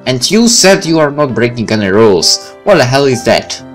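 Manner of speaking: normal talking voice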